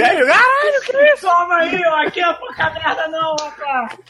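gloating cheers, voice way up; excited shouting. Real, not acted. Celebrating his correct guess.

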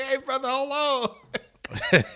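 high, loud, slow call after him. Retold or imitated. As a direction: stretch it out to stop the vendor. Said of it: "I was like".

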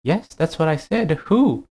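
high hooting owl voice. Acted. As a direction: hoot it like a wise owl.

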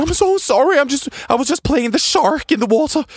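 overly sorry guest caught playing shark; mock apologetic. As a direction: squeal an apology. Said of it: "Og vi bare".

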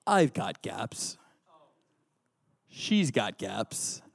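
slow, hushed Rocky impression, partly whispered; preacher doing Rocky's tender line slowly. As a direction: drag the words out softly like a shy Rocky. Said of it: "I'm gonna attempt to do the voices".

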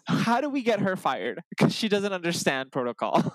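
high-pitched indignant complaint; mock outrage. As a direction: demand it in comic exasperation. Acted outrage at the hostess.

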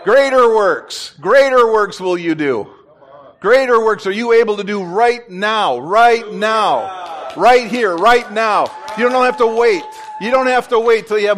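rally the room with fervor; fervent preaching. Real, not acted. Preaching crescendo to congregation.

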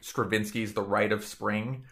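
normal talking voice

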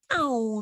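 mock comic-book punch sound effect. Explaining the comic style to her cohost, cartoon punch noise. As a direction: go paun like a cartoon hit. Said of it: "era como el".

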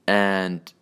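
ordinary delivery

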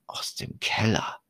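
spooky whisper; hushed horror whisper. A narrator's reading. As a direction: breathe it like a secret. Creepy reveal in horror story.

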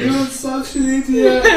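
high squeaky scolding of a dead friend; high scolding grief. Acted. The elf scolds her dead mate in a squeaky high voice.

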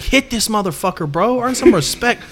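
mock pep talk, pitch climbing; retold hype-up. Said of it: "I'm, like, talking all this shit".

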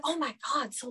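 ordinary talk, nothing marked

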